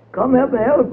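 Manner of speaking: high pleading call from a stuck cow; trapped cow begging the rabbit to help her out. A narrator's reading.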